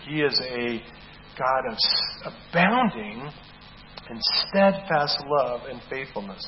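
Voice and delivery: normal talking voice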